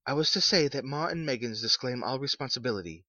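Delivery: a hint of a stiff official; formal messenger. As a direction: read it like a polite clerk. Visitor's formal voice.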